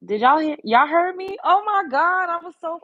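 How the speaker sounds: high, loud thrilled questions; thrilled they could hear; excited disbelief. Real, not acted. Streamer asking chat if they heard her from afar, high thrilled calls.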